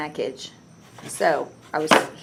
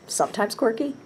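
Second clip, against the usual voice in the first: higher, rising mock disbelief; mock surprised question; 6 semitones higher than usual. Shown as a demonstration. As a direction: question the word quirky, amused. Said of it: "I'll just read it again".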